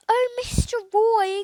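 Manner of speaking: a dismayed cry, high and loud; groaning. A narrator's reading. Boy groans to his tutor over the letter, dismayed cry. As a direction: groan it out at the bad news. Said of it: "groaned Jack".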